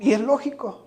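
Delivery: normal talking voice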